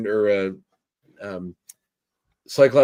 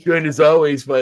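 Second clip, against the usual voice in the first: radio DJ voice; 5 semitones higher, 7 dB louder than usual. Pretend. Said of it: "morning D J".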